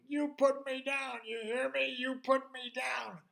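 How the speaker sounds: high, loud, furious man's screaming; angry yelling. Acted. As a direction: bellow demands in outrage. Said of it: "And this voice yelling".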